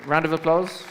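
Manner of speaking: calling out. Real, not acted. Host calls for applause.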